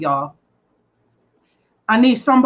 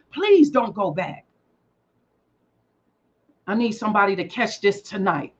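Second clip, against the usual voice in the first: soft plea; 6 semitones lower, 5 dB quieter than usual. Real, not acted. Soft plea after build.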